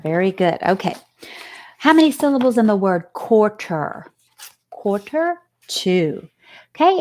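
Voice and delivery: usual speaking voice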